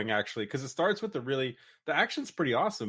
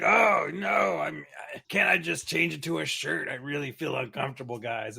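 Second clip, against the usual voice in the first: panicked shy monster voice; 3 semitones higher than usual. Pretend. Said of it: "he's like".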